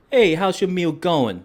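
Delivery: manager voice. Retold or imitated. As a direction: play the chummy manager checking in. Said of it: "The manager comes over and say".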